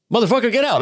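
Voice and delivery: high, excited mock outburst; Bobby's hyped disbelief. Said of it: "'cause that's how he talks".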